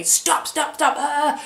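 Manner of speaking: imitating a cop calling out sharply; retold police shout. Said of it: "this police officer was shouting at me".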